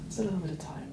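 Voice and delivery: soft, idle, trailing into a whisper; languid god of death. Acted. The god of death teases Anastasia, soft and bored. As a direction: toy with them, bored and amused. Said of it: "you say".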